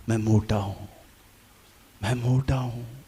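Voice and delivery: quiet, low, dejected mutter; soft gloomy repetition, quietly hurt; brooding self-pity. Retold or imitated. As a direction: mutter it to yourself like a wound. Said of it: "अब मैंने इन शब्दों को ले लिया".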